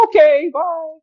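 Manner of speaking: very high, loud, drawn-out farewell; mock cheerful retreat. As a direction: sing out an exaggerated goodbye after an insult. Acting out the reply to insult.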